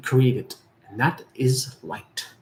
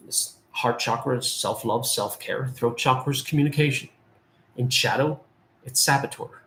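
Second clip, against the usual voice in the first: usual speaking voice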